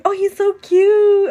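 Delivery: high, loud and slow, stretched out in adoration; husband melting at the puppy, high, loud and slow; melting over a puppy. Retold or imitated. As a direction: coo it like you can't handle the cuteness. Repeats it, drawn out.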